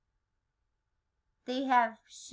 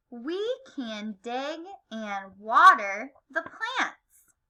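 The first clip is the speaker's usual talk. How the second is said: slow read-along; 2 semitones lower, slower than usual. Shown as a demonstration. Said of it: "Read 'em with me".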